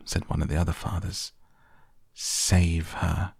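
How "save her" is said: ordinary delivery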